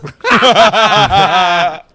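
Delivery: big burst of laughter; loud laugh. Real, not acted. Laughing at own card joke.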